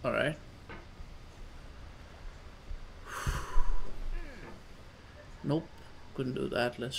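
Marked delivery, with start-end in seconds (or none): 0.4-5.4 long, drawn-out breathy sigh. Real, not acted. Player steadying himself at a hard game, long sigh.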